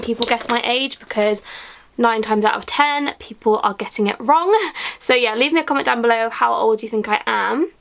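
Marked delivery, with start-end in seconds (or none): none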